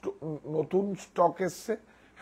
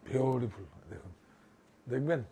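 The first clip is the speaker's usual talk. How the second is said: ordinary talk, nothing marked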